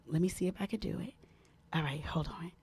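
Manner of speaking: a real hushed whisper. Real, not acted. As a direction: whisper so the phone won't pick it up. Host prepping phone quietly.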